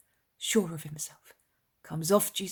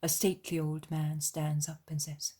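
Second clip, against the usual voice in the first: ordinary delivery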